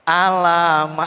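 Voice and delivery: "alama" slowly stretched, the last a long; wrong reading. Shown as a demonstration. Teacher warning students how it might be read wrong. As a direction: drag out "alama" as the mistaken reading. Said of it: "bisa saja dibacanya".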